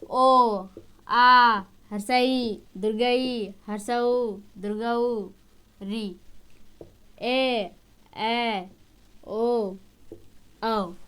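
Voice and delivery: ओ आ हर्षई दुर्गई हर्षऊ दुर्गऊ री ए ऐ ओ औ, each drawn out low and soft; vowel recitation. Shown as a demonstration. Teacher listing the vowels slowly for the class. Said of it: "ये स्वर वर्ण है".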